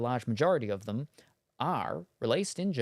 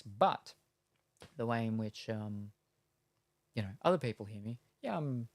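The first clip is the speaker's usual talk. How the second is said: ordinary talk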